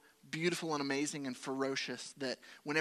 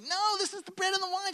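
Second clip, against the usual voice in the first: much higher, louder, eager protest; excited insistence; 7 dB louder than usual. Retold or imitated. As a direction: insist excitedly that it's a sign. Urging wife to take wine.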